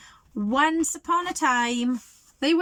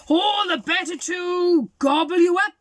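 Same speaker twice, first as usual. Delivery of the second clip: louder, higher hammed-up wolf roar; 8 semitones higher, 7 dB louder than usual. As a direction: roar it to scare the girl. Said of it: "roared the wolf".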